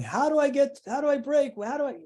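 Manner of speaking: mock the desperate aspiring songwriter; imitating eager hopefuls. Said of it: "people going".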